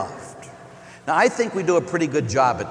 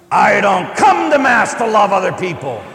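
louder indignant shout; mock angry shout; 4 semitones higher, 6 dB louder than usual. Old man rebuffing the priest, louder indignant shout. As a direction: bark back at the priest in protest. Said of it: "he says".

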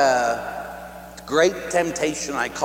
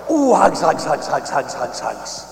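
tender hugging coo. Retold or imitated. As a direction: coo it while squeezing a toddler, fading out. Squeezing his little niece tight.

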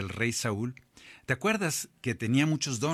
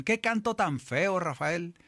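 higher, slow mock sneer at the song; mocking critic; 7 semitones higher, slower than usual. Preacher imitating people mocking a composer's song, higher and slow. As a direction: tease Rafael about his ugly song.

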